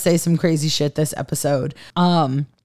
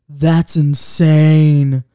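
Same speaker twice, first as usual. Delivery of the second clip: Zaddy; 3 semitones lower, 4 dB louder, much slower than usual. Retold or imitated. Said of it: "And Zaddy says".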